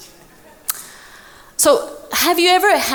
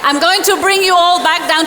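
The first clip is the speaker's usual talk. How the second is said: calling the room back; 6 semitones higher than usual. Real, not acted. Speaker calling the audience back, raised and insistent.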